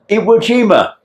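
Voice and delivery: high, excited imitation of a wife; acted-out awed exclamation; amazed announcement. Voicing wife telling woken husband.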